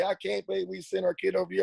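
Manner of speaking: imitating the boy's angry mom and dad, lightly; retold angry parent. Parents from Australia scold him over the crash, mock anger. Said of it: "raised kinds of hell with me".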